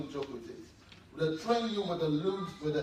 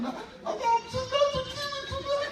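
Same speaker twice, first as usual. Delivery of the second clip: put-on very high wailing child; hammed-up panicked wail, pitched way up; mock crying. Child wails to onlookers about mom, mock crying. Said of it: "when I'm crying like that".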